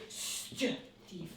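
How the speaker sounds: whispered mock alarm; whispered cry. Shown as a demonstration. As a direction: Stop thief whispered. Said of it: "a man was shouting".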